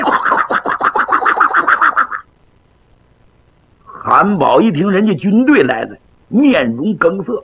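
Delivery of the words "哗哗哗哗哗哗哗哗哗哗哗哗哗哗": 拟声人马喧哗. Acted. 模仿人马嘈杂声.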